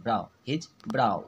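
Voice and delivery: brow repeated with an "ow" glide, ब्राउ; correct pronunciation. Shown as a demonstration. Teacher repeating the corrected phrase for students, brow said with "ow". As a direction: model ब्राउ, brow rhyming with "now". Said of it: "इसको ब्राउ पढ़ते हैं".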